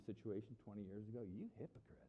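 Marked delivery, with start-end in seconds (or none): 1.2-2.0 low, hushed rebuke; Jesus. Retold or imitated. Jesus speaks to me in small group, quietly accusing. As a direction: say it very softly, as to one person. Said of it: "the word Jesus said to me".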